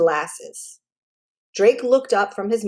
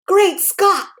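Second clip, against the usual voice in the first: high, loud "Great Scott"; jolted by shock, nearly off the stool; startled exclamation; 11 semitones higher, 4 dB louder than usual. A narrator's reading. Drake hears Frisco's name, blurts a high startled cry.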